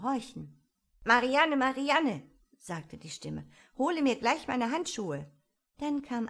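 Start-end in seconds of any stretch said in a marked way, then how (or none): 1.0-2.2 high, loud call for a servant; raised, ringing summons. A narrator's reading. Rabbit calls for its maid. 3.8-5.3 higher, louder command; raised, imperious voice; ordering a servant. A narrator's reading. Rabbit orders its maid about.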